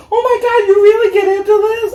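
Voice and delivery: high, fast, gushing; mock thrilled stranger. Imitating a thrilled texter, high and fast. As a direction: squeal it like an excited stranger.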